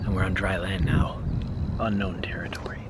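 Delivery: hushed, secretive explorer whisper. Pretend. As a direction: whisper it like you might be overheard. Sneaking onto strange land.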